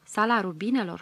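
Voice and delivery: plain reading voice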